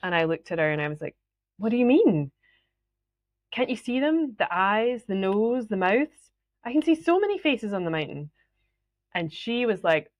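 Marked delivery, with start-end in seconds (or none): 1.6-8.3 retold bafflement, pitched higher; baffled insistence. Retelling her baffled, insistent reply.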